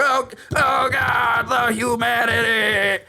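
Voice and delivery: high, slow, anguished wail; horrified cry. Retold or imitated. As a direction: cry out in horror. Reporter dying on the radio.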